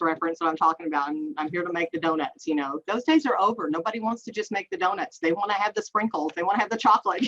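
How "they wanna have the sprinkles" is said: ordinary talk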